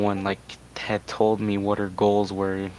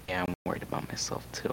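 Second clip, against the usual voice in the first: whispered repeat; 3 semitones lower, 11 dB quieter, faster than usual. Real, not acted. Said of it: "repeated admission".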